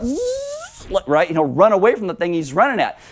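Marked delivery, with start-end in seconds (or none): 0.0-0.9 soft high hissing noise; cat hiss. Pretend. The scared cat hissing away, soft high hiss. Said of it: "he'll be like".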